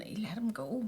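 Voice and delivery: breathy hushed whisper; unvoiced whisper in the story. Real, not acted. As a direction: whisper it like a secret. Whispered aside.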